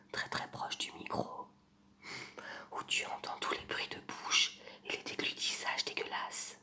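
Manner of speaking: soft close-mic whisper, right in your ear; ASMR whisper. Pretend. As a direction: breathe every word into the mic like an ASMR video. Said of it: "je vais vous faire une imitation".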